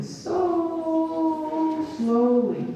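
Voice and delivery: very long, high SO; drawn out. A narrator's reading. Narrator showing how the sea slug moved, drawn out. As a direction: stretch "so" right out before "slowly".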